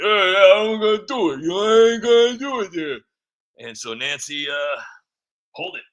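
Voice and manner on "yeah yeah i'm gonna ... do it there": cocky Eric. Retold or imitated. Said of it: "he's like".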